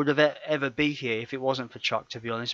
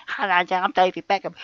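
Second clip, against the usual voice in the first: higher, mocking Beckham voice; mock his high-pitched voice; David Beckham impression; 6 semitones higher than usual. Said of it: "He's got a really high-pitched voice".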